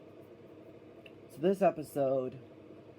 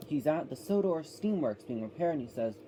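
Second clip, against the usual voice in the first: normal talking voice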